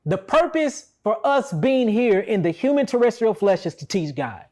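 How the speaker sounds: ordinary delivery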